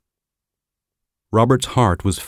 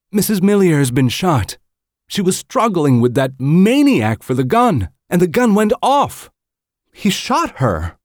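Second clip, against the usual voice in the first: high, loud, panicked woman's voice, slowing at the end; distraught wife; 13 semitones higher, 5 dB louder than usual. Acted. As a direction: pour it out to her husband in shock. Distraught wife reports the shooting.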